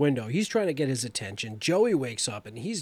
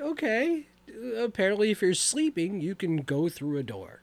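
high, mock-sarcastic aside; 6 semitones higher than usual. Talking to the screen, a high mock-sarcastic comment. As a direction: act baffled and sarcastic about the rule. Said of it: "I'm like".

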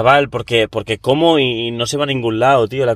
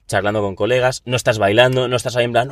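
normal talking voice

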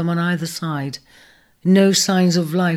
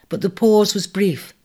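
ordinary delivery, nothing marked

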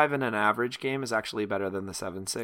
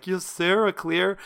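higher, slow, drawn-out mimicry of an opponent; mock the opponent's effortless play, drawing it out; mimicking smug opponent; 9 semitones higher, 4 dB louder, slower than usual. Player retelling the opponent's board clear, mock smug.

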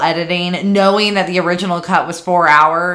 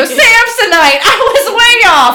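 movie quote voice; 13 semitones higher, 6 dB louder than usual. Retold or imitated. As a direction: ham it up like the movie. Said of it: "I just go".

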